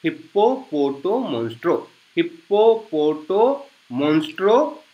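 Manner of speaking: हिप्पोपोटोमोन्स्ट्रो said softly, syllable by syllable, hip-po-po-to-mon-stro; quiet teacher modelling हिप्पोपोटोमोन्स्ट्रो; slow pronunciation demo. Shown as a demonstration. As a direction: spell out हिप्पोपोटोमोन्स्ट्रो gently for students to repeat. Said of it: "मेरे साथ आप pronounce करने की कोशिश कीजिए".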